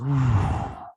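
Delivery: long whooshing blow; imitated ice breath. Showing the grandson's training with ice breath, imitated icy blow. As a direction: blow out a gust of air. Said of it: "with his ice breath".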